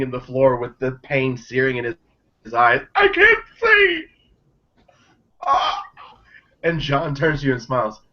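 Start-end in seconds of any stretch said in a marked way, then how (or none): 2.9-4.0 high, slow panicked wail; panicked cry. Acted. Blinded Dacre cries out. 5.4-5.8 pained screams. Acted. Dacre writhing in pain.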